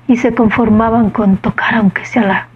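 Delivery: hushed reverent whisper. Real, not acted. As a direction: drop to a whisper in awe. Reverent climax of sermon. (susurrando con reverencia)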